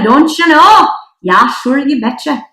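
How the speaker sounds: a jokey North Dakota "don't you know"; North Dakota accent. Retold or imitated. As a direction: play up the Upper Midwest accent. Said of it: "don't let my accent come out".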